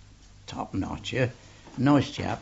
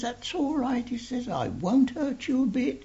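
cocky offer; 13 semitones higher than usual. Retold or imitated. Cherry cockily offering to spar.

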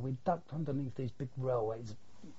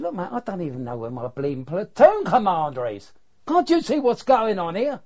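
loud, rising, exasperated yell; exasperated shout; 5 semitones higher, 11 dB louder than usual. Retold or imitated. Sergeant yelling at the lost correspondent, loud and exasperated. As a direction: blast him with frustration. Sergeant losing patience in battle.

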